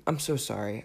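ordinary talk, nothing marked

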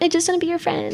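high, soft pleading little voice; whiny little sister. Retold or imitated. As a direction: beg like a clingy kid sister. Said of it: "And I was like".

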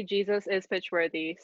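normal talking voice

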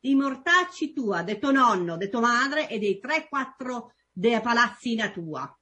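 Roman dialect insult said smoothly; Roman accent. Shown as a demonstration. Guest redoes the Roman insult cleanly in Roman accent. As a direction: rattle off the Roman curse. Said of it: "Let me do it again".

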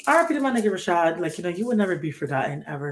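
normal talking voice

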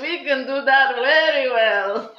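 put-on loud, slow French Canadian accent; hammed-up French Canadian accent. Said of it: "Is that your Canadian accent or your French?".

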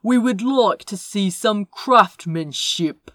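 loud, imperious demand; lightly voiced stern lord; haughty council lord.

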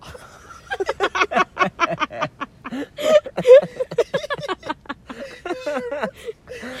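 long high shrieking laugh; can't stop laughing, squealing; high helpless laughter. Real, not acted. Telling friends the ice-cream story, high helpless laughter.

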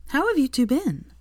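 high, chirpy question; cheery Sophia. Acted. Sophia asking the couple, high and chirpy. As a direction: ask brightly, all friendliness.

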